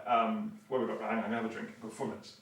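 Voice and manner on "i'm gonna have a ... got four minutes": normal talking voice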